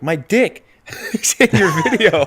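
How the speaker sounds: mock panicked friend, laughing. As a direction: retell his alarm while cracking up. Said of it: "He's like".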